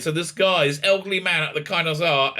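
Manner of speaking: ordinary talk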